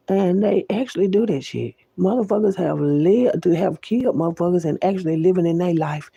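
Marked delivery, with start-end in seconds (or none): none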